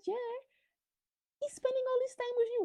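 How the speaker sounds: shrill mock quote, rushed; misquoted high. Vlogger misquoting [name] in a high mocking voice.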